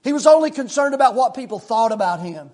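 loud, high, driving preacher's delivery; preaching at full force. Acted. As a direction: press the point home to the congregation. Preaching on the rich man.

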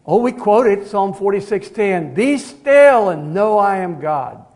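loud, slow proclaiming; preaching at full force. Acted. Preacher calling out the psalm to the congregation, loud. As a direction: ring it out to the back pew.

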